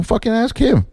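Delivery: high, fed-up outburst of "Fucking ask him"; exasperated snap. Retold or imitated. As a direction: fling it at the stage manager in disgust. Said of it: "I was like".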